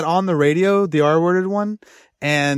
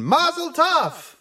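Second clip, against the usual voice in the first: high, slow voice calling mazel tov; background voice; 12 semitones higher, much slower than usual. Retold or imitated. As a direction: call it out like a background hype man. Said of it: "a guy in the background going".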